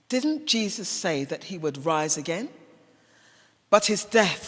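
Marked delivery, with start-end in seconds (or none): none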